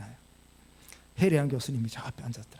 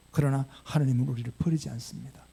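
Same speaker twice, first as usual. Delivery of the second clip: ordinary talk, nothing marked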